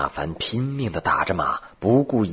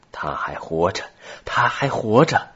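excited cry; 3 semitones higher, 3 dB louder than usual. A narrator's reading. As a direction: start contained, then burst out excitedly. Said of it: "兴奋地叫着".

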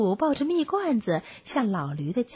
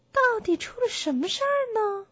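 high-pitched bear voice, asking; concerned little bear; 10 semitones higher than usual. Acted. Bear asks the donkey what happened, concerned.

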